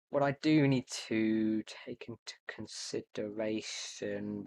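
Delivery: slow, drawn-out words; speaking slowly. Real, not acted. Gamer thinking through a redstone build aloud, speaking slowly. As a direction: stretch the words out, taking your time. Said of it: "why am I speaking so slowly".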